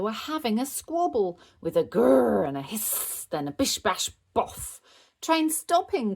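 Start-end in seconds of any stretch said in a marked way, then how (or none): 5.2-6.1 very high loud sing-song call; train conductor call. Acted. Bingo driving the toy train, conductor call. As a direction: announce it like a conductor. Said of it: "called Bluey".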